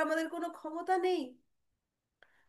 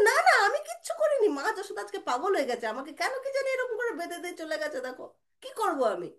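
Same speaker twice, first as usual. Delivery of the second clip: put-on protesting child; 6 semitones higher, faster than usual. Little Krishna pleading innocence to his brother, high and hurried. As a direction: rattle out an innocent excuse.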